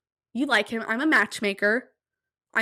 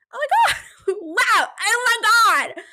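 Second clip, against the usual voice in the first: much higher, louder freak-out with a laugh; panicked outburst; 11 semitones higher, 6 dB louder than usual. Retold or imitated. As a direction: squeal it out in mock panic. Panicking while waiting for news.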